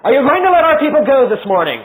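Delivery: high, loud call across the river; crying out. Acted. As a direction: call out to Pharaoh on the bank. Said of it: "Moses cries out".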